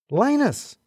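bright high call of a name across the queue; excited call. A narrator's reading. Sue spotting a colleague, calling out excitedly. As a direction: call his name, thrilled to see him. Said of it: "Sue seemed a lot more excitable".

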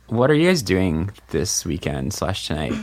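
ordinary talk, nothing marked

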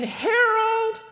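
high, loud yell of a name. Retold or imitated. Wife yelling for her husband into the men's bathroom, loud high shout. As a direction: call him out sharply across the room. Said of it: "She yells again".

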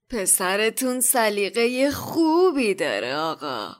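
put-on obsequious merchant voice; fawning shopkeeper. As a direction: sell it to the boy, then slowly flatter his father. Shopkeeper flatters customers, acted in story.

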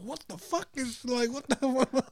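high, soft incredulous squeak; baffled, breaking into a laugh; mock bafflement. Acts out own baffled reaction.

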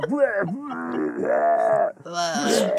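retching bleh sounds; mock puking. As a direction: fake retching noises. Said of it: "Just constantly like".

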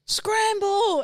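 high shouted "Scramble!"; mock yell of a game call; yelled command. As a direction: call it out like a starting gun. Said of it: "she'd go".

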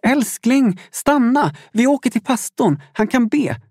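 high-pitched urgent shout; urgent, high-pitched plea to stop; wife calling out. A narrator's reading. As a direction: call after someone running away, pleading. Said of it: "Isabella ropade".

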